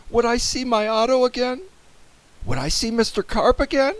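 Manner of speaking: high-pitched worried questions; anxious wondering. A narrator's reading. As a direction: fear for missing friends. Boy fears losing friends.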